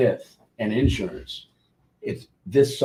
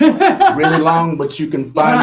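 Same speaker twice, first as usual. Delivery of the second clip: laughing echo; 5 semitones higher, 10 dB louder than usual. Real, not acted. Host echoes guest's joke, laughing.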